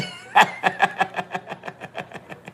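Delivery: long, high, breathless laugh. Real, not acted. Storyteller cracking up after his own ending, long real laugh. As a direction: laugh helplessly until out of air.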